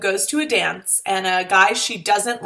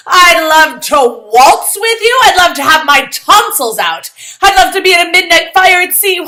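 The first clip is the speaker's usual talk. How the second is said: sarcastic gushing; 9 semitones higher, 10 dB louder than usual. Acted. Girl mocking her forced acceptance, loud sarcastic gush. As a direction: pile on the mock enthusiasm.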